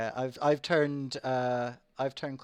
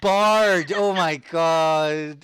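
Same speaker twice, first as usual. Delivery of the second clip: exasperated laugh; 4 semitones higher, 9 dB louder, much slower than usual. Real, not acted. GM exasperated at the mixup.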